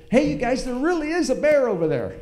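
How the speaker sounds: high, loud urgent warning repeated; urgent insisting call. Retold or imitated. Warning the disbelieving family again, high urgent call. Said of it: "And I said".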